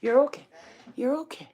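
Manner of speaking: high gentle reassurance. Real, not acted. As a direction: coo to calm her. Soothing scared dog.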